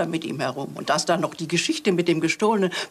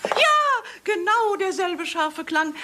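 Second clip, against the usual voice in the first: a high, eager "Ja"; excited yes; 12 semitones higher, slower than usual. Acted. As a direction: leap on it in recognition. Recognising the same sound. (aufgeregtes hohes Ja)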